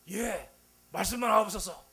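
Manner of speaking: loud, high, hammed-up eager shout; eager young Moses. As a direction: answer like a keen recruit, far too loud. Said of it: "젊은 모세는 찾아와가지고".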